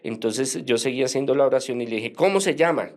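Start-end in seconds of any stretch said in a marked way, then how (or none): 2.1-2.9 retold exorcist's command, pitched up and forceful; rebuking demand. Seminarian questioning the possessed woman mid-prayer, firm rebuke. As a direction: demand it firmly, higher. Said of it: "Ya más increpado, más, más, eh, eh, fortalecido en la oración".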